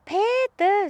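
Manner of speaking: high mock shout of Peter; calling out a name. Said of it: "jag skrek". (ropar Peter högt)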